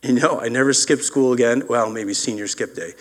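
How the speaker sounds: usual speaking voice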